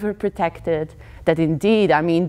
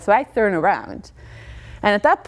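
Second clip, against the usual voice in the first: normal talking voice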